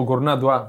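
Γκουρνά pronounced as written, with a Greek r; as spelled. Shown as a demonstration. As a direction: read Γκουρνά Ντουά letter by letter, without French accent. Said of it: "έτσι τον γράφω εγώ". (Γκουρνά με ελληνικό ρο, όπως γράφεται)

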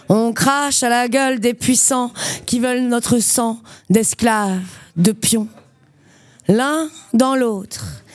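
intense spoken-word performance; slam poetry. Acted. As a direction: recite it like a slam poem on stage. Performs her slam poem.

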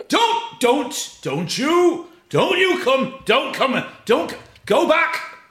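high, loud stammering yell to stay away; panicked yelling. Retold or imitated. Paul yelling at his friend not to come near, panicked. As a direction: stumble over the words in a panic.

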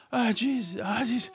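soft, winded groaning; acted exhaustion. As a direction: act spent after a desperate sprint, under your breath. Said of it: "And I'm dying".

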